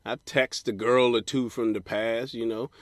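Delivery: ordinary talk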